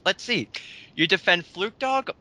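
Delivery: ordinary talk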